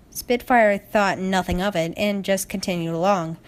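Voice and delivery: usual speaking voice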